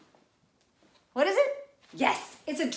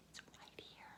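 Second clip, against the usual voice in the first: whisper; 29 dB quieter, faster than usual. Acted. Teacher hints in a whisper.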